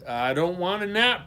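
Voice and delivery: storybook fury, moderately done; furious protest. A narrator's reading. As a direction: protest crossly like a small boy. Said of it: "protested Johnny furiously".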